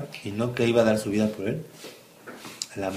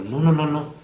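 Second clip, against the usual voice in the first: higher, louder flustered no's; panicked denial; 6 semitones higher, 7 dB louder than usual. Acted. Peter denies knowing his master, panicked and loud.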